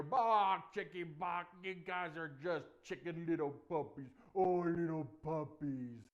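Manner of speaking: loud chicken-clucking jeer; mock them loudly, stretching the insults; taunting Goliath.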